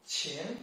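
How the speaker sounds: "qian" sliding upward; second tone. Shown as a demonstration. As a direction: lift "qian" like a question. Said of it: "second tone".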